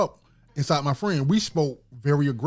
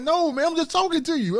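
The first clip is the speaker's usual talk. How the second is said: retold protest; 13 semitones higher than usual. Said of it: "Man, you real aggressive".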